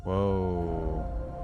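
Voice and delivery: ordinary talk, nothing marked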